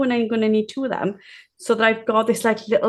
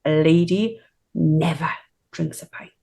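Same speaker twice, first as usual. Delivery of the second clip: lightly voiced gran, low and deliberate; gran's admonition; 4 semitones lower than usual. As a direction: imitate gran's confidential correction. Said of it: "leaned over to me and she went".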